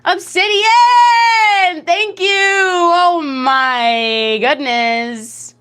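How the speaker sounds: high, loud, drawn-out shout of thanks; overjoyed shriek. Real, not acted. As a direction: scream thanks at a big tip. Thanking a big donation.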